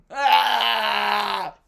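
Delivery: short loud frustrated yell; angry shout. Acted. Venting anger to a friend, loud shout. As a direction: blow off steam with a yell. Release after acted angry reply.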